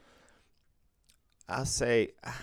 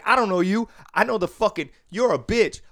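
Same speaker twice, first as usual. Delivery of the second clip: high, louder imitated thug shouting; mock angry yell; 10 semitones higher, 9 dB louder than usual. Drunk patron yelling insults at the worker.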